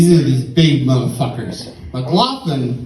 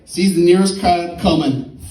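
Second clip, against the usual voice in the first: ordinary delivery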